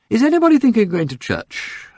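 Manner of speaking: high, lightly voiced Duchess asking the table; a gently voiced Duchess's question. A narrator's reading. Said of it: "asked the Duchess".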